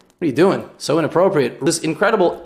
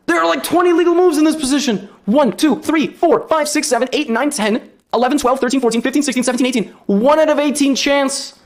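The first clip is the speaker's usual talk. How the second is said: high-pitched, agitated counting; worked-up and high; incredulous exasperation; 9 semitones higher than usual. Real, not acted. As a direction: rattle it off in high, outraged amazement.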